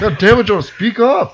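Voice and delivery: mock-irritated demand to speak up; exasperated. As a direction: snap it out, pitch jumping up.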